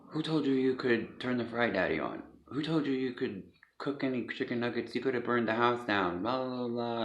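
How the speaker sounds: put-on a touch of an angry old man accusing; retold angry grandfather. Grandfather berating the boy.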